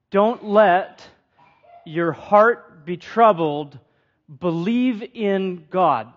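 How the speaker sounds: slow, weighty, every word set apart; the preacher reads Jesus's words to the congregation, slow and deliberate; slow emphatic reading. Shown as a demonstration. Lean on "Don't" and let each word land slowly. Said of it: "Kind of peculiar that he says that".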